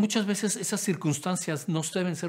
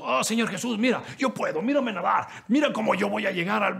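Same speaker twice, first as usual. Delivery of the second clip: mock boasting; 7 semitones higher, 4 dB louder than usual. Mocking a self-reliant Pedro.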